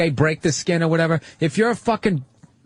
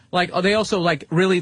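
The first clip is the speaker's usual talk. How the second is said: usual speaking voice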